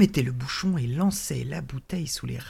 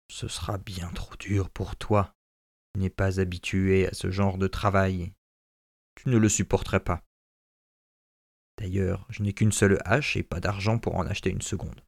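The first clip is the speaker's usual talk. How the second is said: lower, a lightly voiced weary old father; 4 semitones lower than usual. Father to his son about the work, lightly lower and weary. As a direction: talk him out of it gently, lower.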